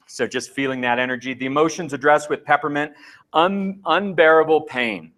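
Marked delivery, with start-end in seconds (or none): none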